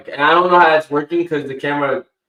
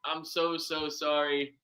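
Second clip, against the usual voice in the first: robot voice; 2 semitones lower, 18 dB quieter than usual. Real, not acted. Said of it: "I think I might sound like a robot right now".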